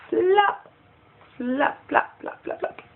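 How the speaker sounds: "flop" starts high, then fades to a whisper; fading repetition. Acted. Vlogger mocks Tamar's ideas with fading flops. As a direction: let each "flop" die away.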